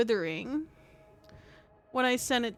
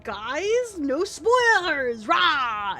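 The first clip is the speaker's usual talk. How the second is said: mock-scolding Boo; 4 semitones higher, 6 dB louder, much slower than usual. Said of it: "she'll be like".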